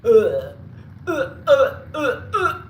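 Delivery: loud, high repeated "uh" of revulsion; disgusted grunts. Pretend. Recoiling at the thought of octopus, disgusted grunts. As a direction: grunt in mock revulsion, in short bursts.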